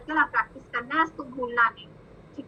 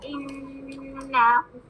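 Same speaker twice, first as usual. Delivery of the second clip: व इन्नहु very slow, ghunna held two counts, हु stretched; slow tajweed recitation; much slower than usual. Shown as a demonstration. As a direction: chant व इन्नहु with rounded व. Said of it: "नून मुशद्दद का गुन्ना टू counts".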